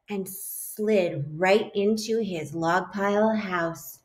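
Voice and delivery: usual speaking voice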